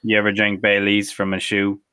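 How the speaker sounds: ordinary delivery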